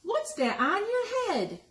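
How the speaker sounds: high, clucky hen asking; mother hen voice. Acted. Mother hen teasing Hedgie, high hen voice.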